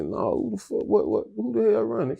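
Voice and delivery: high-pitched stammered comeback; retold bewildered questioning; baffled disbelief. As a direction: act taken aback, tripping over the question. Acted baffled reply in a story.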